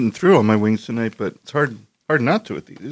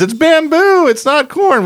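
high squeaky mock defense; 3 dB louder, slower than usual. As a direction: squeak it out like a whiny excuse. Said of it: "I know the defense is".

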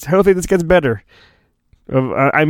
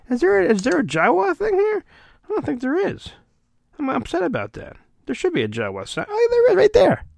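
much higher, tight squeaky voice; high-pitched mock whine; squeaky high excitement; 13 semitones higher, 5 dB quieter, much faster than usual. Real, not acted. Excited search for Jawa sticker.

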